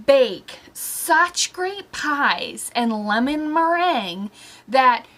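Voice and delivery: ordinary talk, nothing marked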